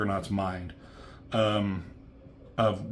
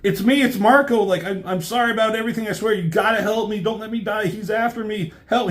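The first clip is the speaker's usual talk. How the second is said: much higher, loud, fast terrified begging; desperate pleading; 11 semitones higher, 6 dB louder, faster than usual. Retold or imitated. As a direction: beg for your life. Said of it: "He's like".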